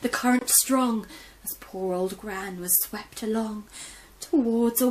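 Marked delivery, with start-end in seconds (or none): none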